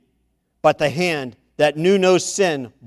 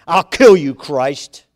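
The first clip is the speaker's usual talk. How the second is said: louder threatening yell; Satan screams; 7 dB louder than usual. Acted. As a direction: spit out the threat. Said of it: "Satan screamed".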